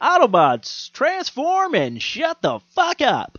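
high-pitched, drawn-out mock rallying cry; mock battle cry. Mock promo for a hate podcast.

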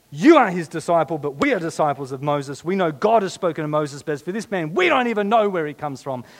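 loud, indignant scripture declamation; reviling. Acted. Accusers revile healed man before the court, loud acted scorn. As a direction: hurl the words at him forcefully. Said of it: "they reviled him".

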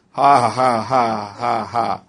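joyless ha-ha, stepping down in pitch; dry forced laugh. Retold or imitated. Man answering the devil's threat, dry forced laugh. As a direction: force out laughter without feeling it. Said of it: "Start laughing again".

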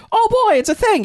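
very high, loud excited kid impression; mock childish glee. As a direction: squeal it like a thrilled child. Giant kid grabbing the toy.

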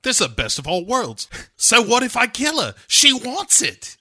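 high-pitched, hammed-up character voice; Bond. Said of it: "Right, and he's like".

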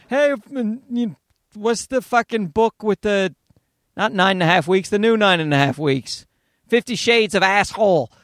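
ordinary talk

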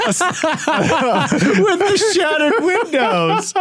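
laughing through the words; cracking up while talking. Real, not acted. Laughing at a funny picture.

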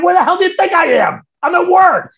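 loud, high, fast exasperated retort; retold sarcastic shouting; sarcastic outburst. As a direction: yell it back as if it were obvious. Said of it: "I was sarcastic".

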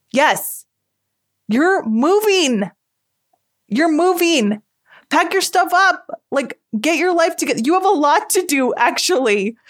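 high, exasperated self-scolding. Pretend. Scolding herself about moving.

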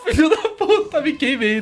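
hammed-up pained child's wail; mock crying yelp. Narrator reliving burning himself as a kid, mock cry. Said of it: "eu chorando".

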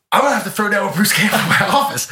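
fast, high, loud blurt; comic disbelief at a looming fight; mock alarm. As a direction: re-enact a panicked realisation. Acted fan realising a fight looms.

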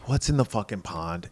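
quiet, worn-out grumble; defeated. Acted. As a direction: say it softly, like you've given up. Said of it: "he's just kind of defeated".

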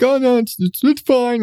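high, dopey idiot voice; stammering clueless fool. Retold or imitated. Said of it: "my default idiot voice".

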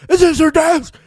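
high, loud frantic question; hammed-up crazed query; manic Cage question. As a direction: ask it like a madman. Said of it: "he goes to, like".

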